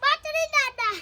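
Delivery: loud, high-pitched answer; loud on request. Real, not acted. Contestant told by the host to speak up, loud answer. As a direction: belt the answer out. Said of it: "જોરથી બોલ".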